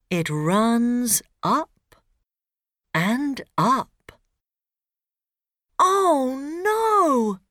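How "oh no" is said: put-on high worried boy; alarmed little boy. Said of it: "says Ben".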